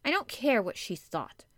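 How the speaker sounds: touch of sulky defiance; frowning defiance. A narrator's reading. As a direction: frown as you brush it off.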